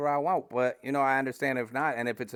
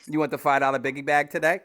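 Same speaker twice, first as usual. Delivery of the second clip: fast, loud drive-thru order taker; drive thru speaker; 4 semitones higher, 6 dB louder, faster than usual. Pretend. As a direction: rattle it off like a fast-food cashier. Wendy's drive-thru worker joke.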